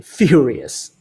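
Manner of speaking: usual speaking voice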